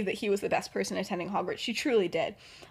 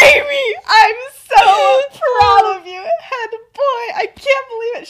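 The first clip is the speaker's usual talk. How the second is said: high, loud squeal of motherly pride; going off, ecstatic; 11 semitones higher, 11 dB louder, slower than usual. Acted. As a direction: shriek with pride, stretching the words. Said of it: "She's, like, insane. She's really going off".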